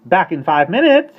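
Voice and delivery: high, loud call over the shoulder; screaming. Acted. Starling flying off, screaming back at the nanny high and loud. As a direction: scream it back as you fly. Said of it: "he screamed at her".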